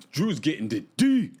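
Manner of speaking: imitating her emphatic confessional line, punching the D; mock bassy voice. Host imitates Angela's confessional about Drew, mock bassy and emphatic. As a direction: put some mock bass in it, hit D hard. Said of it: "she put some bass in her voice".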